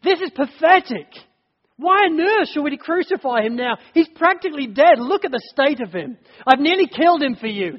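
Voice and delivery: exasperated, arguing with the crowd; exasperated plea. Retold or imitated. Pilate pleading with the crowd, scornful and exasperated. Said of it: "The way it's written in the Greek is".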